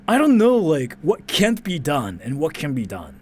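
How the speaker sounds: ordinary talk, nothing marked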